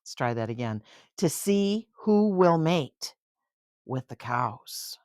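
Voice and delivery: storybook narration, lively and clear; bedtime-book reading voice; reading to a child. A narrator's reading. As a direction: read it aloud to a little one.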